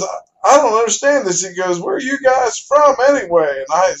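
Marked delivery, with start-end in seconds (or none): 0.0-1.4 higher-pitched, baffled protest; flustered stammer. Retold or imitated. Warden baffled by the anglers' many licenses, flustered. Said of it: "stammering around and hemming and hollering". 1.8-3.6 high, baffled demand; exasperated hollering. Retold or imitated. Warden questioning two well-licensed anglers, exasperated. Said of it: "stammering around and hemming and hollering".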